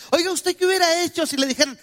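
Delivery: ordinary delivery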